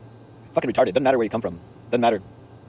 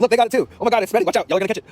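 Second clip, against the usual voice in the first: high, loud mock-panicked warning; excited, high-pitched shout of playful alarm; 11 semitones higher, 6 dB louder than usual. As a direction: call out a pretend outbreak to the whole store. Acting out mock alarm at spreading smiles.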